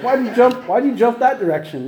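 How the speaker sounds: imitating a half-joking blame on the girl; mock accusing question.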